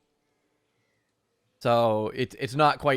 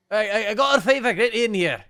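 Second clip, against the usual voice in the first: high, loud and fast call; 11 semitones higher, 11 dB louder than usual. Acted. Priest calling Milivoj in from digging, loud and hurried. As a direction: hurry him in with a shout.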